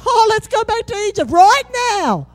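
high, hammed-up whine; whiny complainer. Pastor voicing the crew that wants to turn back, high whine. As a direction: moan like a sulking deserter. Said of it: "This other crew is saying".

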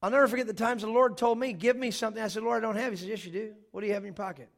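loud, emphatic scripture preaching. Acted. Pastor preaching to the congregation, loud and emphatic. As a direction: proclaim the text with full force.

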